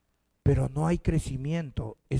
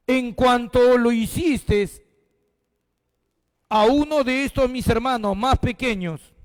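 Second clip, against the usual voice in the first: slow, higher, louder proclamation; solemn preaching; 6 semitones higher, 4 dB louder than usual. Acted. Pastor reading the king's words to the church, slow and solemn. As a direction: declare the king's verdict solemnly. Repeats the verse.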